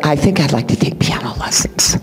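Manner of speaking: whispered, shy request. Retold or imitated. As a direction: breathe it out like a secret. Student shyly asking for lessons.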